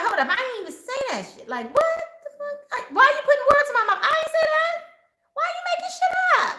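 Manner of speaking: high, fast, incredulous protest; indignant outburst. Acted. To someone putting words in her mouth, high indignant protest.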